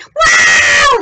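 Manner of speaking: high, long, loud yell; piercing howl of alarm; robber's scream. Acted. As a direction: holler out as the bitten robber. Acts out the tossed robber screaming.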